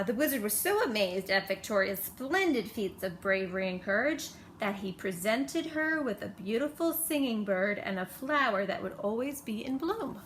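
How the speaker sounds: plain reading voice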